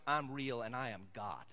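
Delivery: low, hushed hint of God's voice; understated, quiet divine declaration. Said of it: "and God goes".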